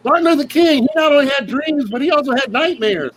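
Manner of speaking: high, eager know-it-all voice. Retold or imitated. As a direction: share trivia like an overeager colleague. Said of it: "they're like".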